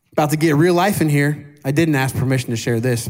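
ordinary talk, nothing marked

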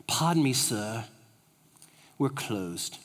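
put-on polite English shop lady in a mild British accent. Lady turning the visitor away, mild British accent. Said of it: "How was my accent? Was that okay?".

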